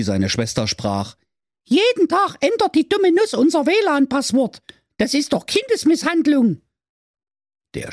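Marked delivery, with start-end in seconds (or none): 1.6-6.6 put-on much higher indignant girl voice; little sister. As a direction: complain like an outraged kid sister. Sister figure.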